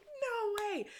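high-pitched squealed echo; excited disbelief. Retold or imitated. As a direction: squeal back at the friend, very high. Echoes friend's excitement.

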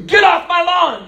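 put-on high, loud shout at kids; old man yelling at kid on his grass; angry old man. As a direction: bellow like a cranky old man. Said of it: "those angry old men".

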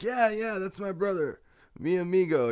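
high-pitched mock eager reply; acted eager confirmation. Searcher claiming his brother from the driver, mock eager. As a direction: retell it high, quick to confirm.

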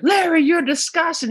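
very high scandalized cry; mock outrage. Hugged woman scolding Larry, high mock outrage.

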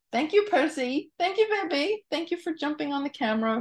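high sing-song thanks to the kitten. Real, not acted. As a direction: coo at the cat, high and light. Thanking the cat on camera.

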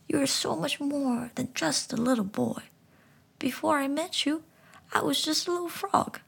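talking frog gently explaining; high frog voice. Acted. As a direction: speak like a wise little frog. Frog tenderly encourages boy.

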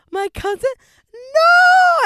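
very high, loud wailing scream; full-blown retold meltdown; losing it. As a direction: scream in horrified disbelief, building to a long NO. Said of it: "I fucking lose it".

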